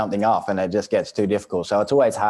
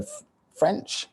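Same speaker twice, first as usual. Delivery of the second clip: disbelief; 14 semitones higher, 5 dB quieter than usual. Retold or imitated. Son shocked at dad's French claim.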